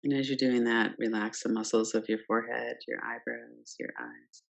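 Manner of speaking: ordinary talk, nothing marked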